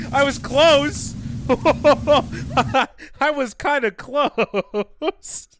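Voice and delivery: slow, loud, laughing. Real, not acted. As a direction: laugh through the words. Streamer laughing at guess.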